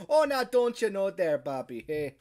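put-on loud, high Midwest accent. Host mimicking the locals around here, hammed-up Midwest accent. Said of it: "you mean the Midwest accent".

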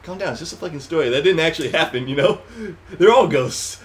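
ordinary talk, nothing marked

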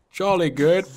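high loud posh British "jolly good"; British accent. Pretend. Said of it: "He's British".

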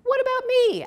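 high, eager little frog voice; Freddie's high voice. A narrator's reading. Freddie asking to help, high and eager. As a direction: pipe it up like a young frog. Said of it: "asked Freddie".

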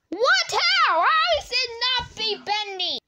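loud, high-pitched disbelief; shocked outburst. Pretend. Player reacting to news that Benny is not the imposter, shocked. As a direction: play stunned, voice leaping up.

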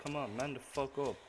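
ordinary talk

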